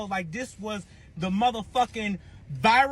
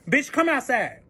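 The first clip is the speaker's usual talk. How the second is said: higher, insistent call; retold impatient yell; calling someone out; 6 semitones higher, 4 dB louder than usual. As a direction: demand it through a closed door. Repeats the call at the door.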